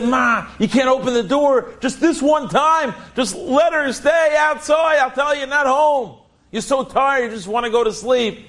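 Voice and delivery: put-on high, pleading child's voice, stretched on the middle; a daughter begging her mother to leave the guest outside. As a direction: beg Ma like a tired kid. Child begging mother not to open.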